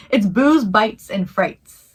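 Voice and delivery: slowly, word by word; slow careful saying. Real, not acted. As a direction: spell out the name carefully. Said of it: "It's a tongue twister".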